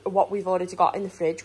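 ordinary talk, nothing marked

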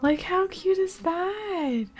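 high, slow, cooing over something adorable; cutesy coo. Real, not acted. Gamer cooing at her cute Sims baby, high and slow. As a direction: draw it out sweetly, way up high.

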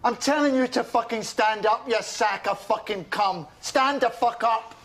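high, drawn-out command; insistent, furious demand; angry order. Acted. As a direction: hammer out each word in anger. Ordering someone to stand.

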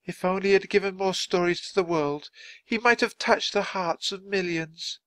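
high-pitched, sorrowful lament; mournful young woman. A narrator's reading. Young woman to mourners at the grave, sorrowful. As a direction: mourn him, voice held high. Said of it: "broke down in tears again".

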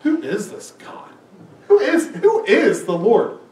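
scoffing, half-laughing cocky retort; mild mock arrogance with a laugh; cocky Pharaoh. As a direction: toss it off like an arrogant king. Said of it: "egotistical enough to say".

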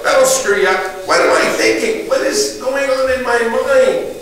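ordinary delivery

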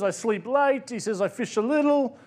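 put-on a touch of Mexican accent, easygoing. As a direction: lightly voice a relaxed Mexican fisherman. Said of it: "I apologise for the accent".

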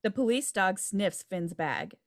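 ordinary talk, nothing marked

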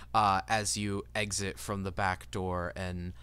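ordinary talk, nothing marked

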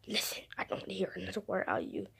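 fast threatening whisper; menacing whisper. Acted. Bully toy silencing the puppy again, whispering. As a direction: hiss the threat low and quick.

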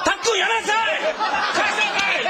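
frantic dad scolding; man scolding child. Retold or imitated. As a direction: yell at a kid in a store.

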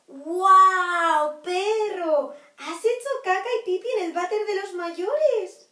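loud, high, delighted cheer; excited praise. Acted. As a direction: celebrate the puppy proudly. Praising the puppy loudly. (elogio entusiasmado y fuerte)

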